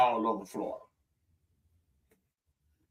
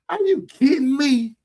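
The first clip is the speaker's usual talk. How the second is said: amazed disbelief; 10 semitones higher, 4 dB louder than usual. Real, not acted. Amazed at a clip.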